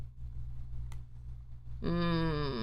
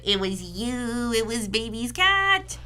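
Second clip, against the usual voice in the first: mock accusing cat voice; 4 semitones higher, slower than usual.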